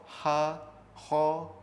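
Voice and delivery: ordinary delivery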